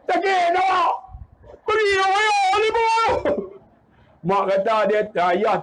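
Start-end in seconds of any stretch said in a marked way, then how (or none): 0.1-3.1 high-pitched tearful protest; wailing child. Retold or imitated. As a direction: mimic a hurt child crying out. Beaten child wailing at parents.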